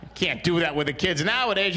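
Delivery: ordinary delivery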